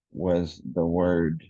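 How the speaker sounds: usual speaking voice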